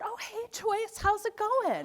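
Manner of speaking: put-on unbothered mom while her child screams; breezy greeting. Mom greeting calmly amid tantrum.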